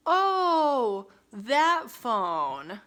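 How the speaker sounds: loud, drawn-out fake "oh"; hammed-up feigned innocence, high then dropping; mock realization. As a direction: exaggerate a sudden, phony recollection. Mocks bartender pretending to remember.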